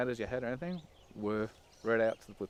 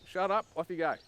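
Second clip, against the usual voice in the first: curt corporal; 8 semitones higher, 8 dB louder than usual. Retold or imitated. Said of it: "The guy goes".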